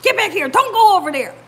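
husband's urgent shout, imitated; imitated yelling. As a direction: order the dogs away from danger. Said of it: "He was yelling at him too".

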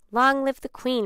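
high, loud cheer; repeated acclamation. A narrator's reading. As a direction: proclaim it to the whole crowd. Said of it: "repeated the tortoise".

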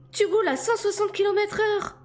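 high-pitched, horrified yell; loud, alarmed woman's shriek; panicked bawling. A narrator's reading. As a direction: bawl it in her high voice. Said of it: "brailla-t-elle".

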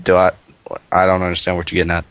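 ordinary delivery

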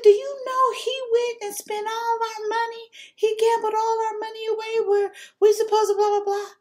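high, loud indignant rant; angry complaint. Retold or imitated. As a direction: vent at her at full pitch. Complaining loudly to Dina.

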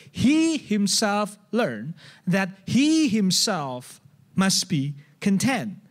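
slow, weighty stress on "He himself", each word spaced out. Shown as a demonstration. Preacher slowly stressing "He himself" to the congregation. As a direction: lean on "He himself" and let it sink in. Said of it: "That's kind of the proper sentence".

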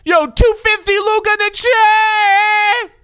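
high, loud frantic yelling; hyped streamer scream; excited shout. Real, not acted. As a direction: shout the apology and thanks. Screaming apology and thanks.

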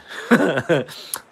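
high burst of laughter; laughing. Real, not acted. Amused by his own sixties man voice, laughing. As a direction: crack up at your own voice.

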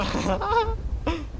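high, crying laugh of relief after a scare; nervous laugh. Real, not acted. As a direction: laugh the fear out. Risa tras el susto. (risa nerviosa y aguda)

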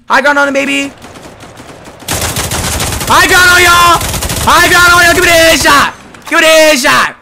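high, loud triumphant jeer; taunting shout. Real, not acted. Gamer taunting the enemy from high ground, shouting. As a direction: crow at them from the high ground.

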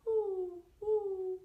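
high, loud hooting owl; long high owl hoots; owl call. A narrator's reading. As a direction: hoot it out like an owl. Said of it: "Mister Owl calls".

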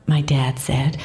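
ordinary delivery, nothing marked

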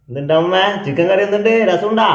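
high, loud questions to grandma; loud calling. Real, not acted. Grandson asking grandma if the curry is tasty, loud calling. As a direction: call out so she can hear.

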